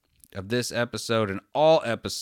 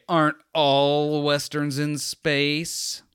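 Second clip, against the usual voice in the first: slow, smug, higher know-it-all drawl; sarcastic confidence; 7 semitones higher, 4 dB louder, much slower than usual. Retold or imitated. Podcaster mocking his own smug question, drawn out. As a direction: lean into the smugness, stretch every word. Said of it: "sarcastic confidence".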